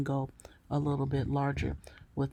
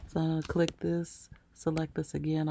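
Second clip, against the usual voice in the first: ordinary delivery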